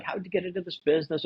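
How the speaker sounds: high, quick, friendly sales question; friendly salesperson question. Shown as a demonstration. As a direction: ask it brightly and fast, like small talk. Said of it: "we'll ask is something like".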